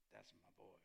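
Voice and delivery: proud big dog. Retold or imitated. Butch praising his little dog from above, low and proud. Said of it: "Daddy sit there going".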